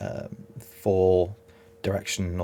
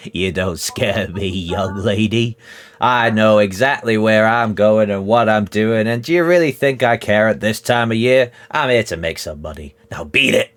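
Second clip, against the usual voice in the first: defiant trader; 3 semitones higher, 8 dB louder than usual. Acted. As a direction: brush her off, unimpressed. Trader rebuffs the threat.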